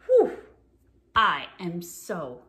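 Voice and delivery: ordinary delivery, nothing marked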